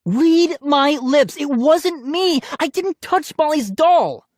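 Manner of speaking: high, loud, slow emphatic yell; screaming. A narrator's reading. Brother insisting he never touched the doll, screaming. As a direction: scream each word out slowly. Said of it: "Ian screamed".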